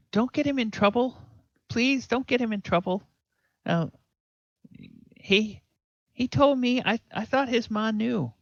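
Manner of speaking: put-on a scared boy covering for his friend; pleading kid. Pip begging the farm woman not to punish her son. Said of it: "Pip says".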